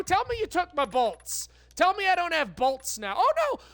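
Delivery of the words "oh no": high, sudden cry of dismay; a sharp, high-pitched gasp of alarm. Real, not acted. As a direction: yelp it as the bad news lands. Dismay on seeing missing bolts.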